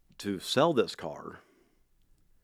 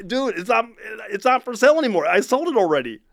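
higher, louder exasperated reply; 12 semitones higher, 7 dB louder than usual. Retold or imitated. Seller tells yet another caller the car is sold, exasperated. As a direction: tell the caller off, fed up.

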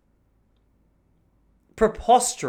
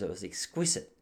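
a touch of lowered, softer fancy voice; mock posh diner; 6 semitones lower, 11 dB quieter than usual. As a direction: lightly play the snob savouring his meal. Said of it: "He didn't say exquisite".

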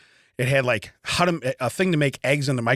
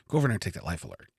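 rushed and hushed, pushing him to grab it; low fast conspiratorial nudge; quick hushed dare; 9 semitones lower, 8 dB quieter, much faster than usual. Retold or imitated. Egging on son to steal gift.

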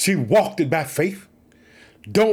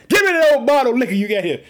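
higher, louder mock rebuke of Eli; stern priest's scolding; 6 semitones higher, 4 dB louder, faster than usual. Preacher acting out Eli scolding the praying woman, hammed-up rebuke. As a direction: bark it out like a stern old priest.

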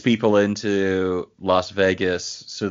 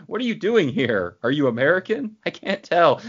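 baffled mock questions; 9 semitones higher than usual. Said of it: "So it's like".

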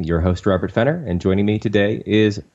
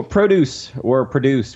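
"Produce" said once stressed on PRO and once on DUCE, slowly; both stresses of the name; 6 semitones higher, slower than usual. Shown as a demonstration. Host naming the studio both ways, slowly contrasting stresses. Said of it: "where you stress the syllable".

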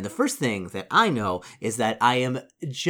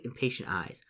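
ordinary talk, nothing marked

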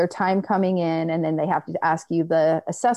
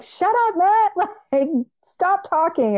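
much higher, exasperated cry; frantic snap; 13 semitones higher than usual. Retold or imitated. Woman in labour telling her brother to shut up, shrill and frantic. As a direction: shriek at him to stop talking. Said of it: "And I was frantic".

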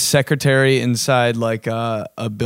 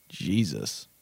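normal talking voice

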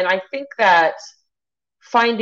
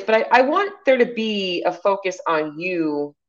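normal talking voice

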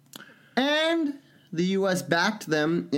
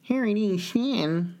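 higher, goofy echo of the name; playful higher repeat of Harry Dean Stanton; mock silly echo; 7 semitones higher than usual. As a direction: toss the name back in a silly voice.

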